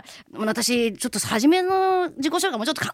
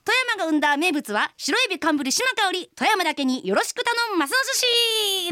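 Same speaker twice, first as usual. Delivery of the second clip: pitched higher, a big bright pitch for the audience; showpiece self-introduction; 6 semitones higher than usual. Shown as a demonstration. The singer performs her finished intro for her co-host, pitched up and hammed. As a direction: sell the catchphrase like a stage debut. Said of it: "出来上がったよって聞いてくれる？".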